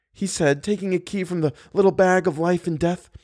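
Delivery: high-pitched woman's narration; voiced heroine telling her story; Christine, distressed. A narrator's reading.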